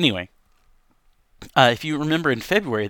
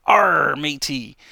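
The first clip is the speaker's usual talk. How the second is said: lightly voiced pirate growl; a quick hammy pirate call; 3 semitones higher, 7 dB louder, much slower than usual. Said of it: "Talk Like a Pirate Day".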